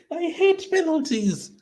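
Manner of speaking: very high, slow squealed complaint; distressed squeal. Real, not acted. Tense fan watching a shootout, distressed squeal. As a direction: squeal it out in drawn-out anguish.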